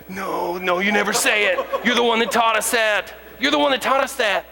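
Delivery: put-on high, loud childlike protest; kids protesting. Daughters scolding their father, high, loud kid voices. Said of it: "And they went".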